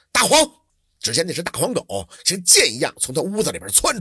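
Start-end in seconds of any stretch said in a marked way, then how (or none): 0.1-0.5 a sudden high, loud call across the yard; loud shout. A narrator's reading. Scholar calls his big yellow dog to attack, loud shout. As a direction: yell the dog's name to send it in. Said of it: "他回头大喊了一声".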